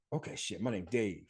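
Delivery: hushed, low, to himself; half under his breath, deciding on the name; muttered realization. Retold or imitated. Seeing the bus sign, he quietly names himself. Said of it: "And I was like".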